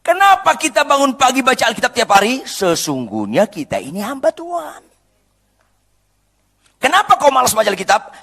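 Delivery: high, loud preaching challenge; fiery sermon question; preaching at full force. Acted. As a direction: rouse the church with each question.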